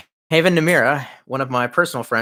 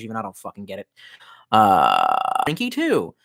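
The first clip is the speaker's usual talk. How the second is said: usual speaking voice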